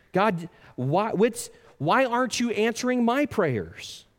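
high, halting mock complaint to God; frustrated pleading. Acted frustrated prayer to God.

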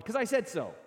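put-on impatient parent cutting a child off; stern parent. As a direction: snap it at the kid like a fed-up parent. Parent answering child in example.

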